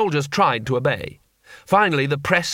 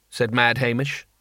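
plain reading voice

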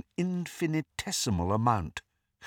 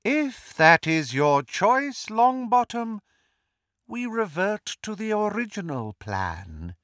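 silky menace; 9 semitones higher than usual. A narrator's reading. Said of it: "more danger in the silkiness of his voice".

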